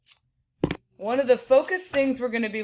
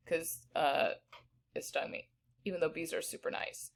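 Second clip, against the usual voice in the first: quiet aside; 4 semitones lower, 11 dB quieter than usual. Real, not acted. Teacher aside, dropped voice.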